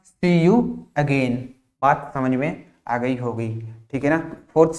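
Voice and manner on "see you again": see you again, drawn out word by word; slow model phrase. Shown as a demonstration. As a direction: teach it slowly, stretching each word. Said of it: "दोबारा मिलेंगे का क्या होगा". (धीरे-धीरे खींचकर बोलें)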